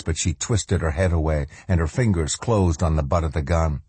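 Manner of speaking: ordinary delivery, nothing marked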